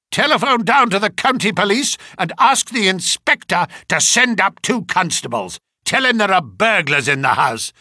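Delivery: loud, curt orders to the butler; angry command. Acted. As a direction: bark the order. Colonel ordering police called.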